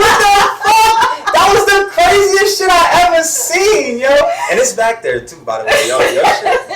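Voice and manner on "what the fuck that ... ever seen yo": stunned disbelief. Real, not acted. Disbelief at twerking story.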